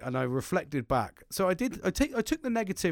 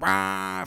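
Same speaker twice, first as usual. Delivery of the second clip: imitated engine-like roar, raised pitch; excited machine roar; 5 semitones higher, 4 dB louder than usual. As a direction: let out a playful "raah". Retelling thrill of using machinery.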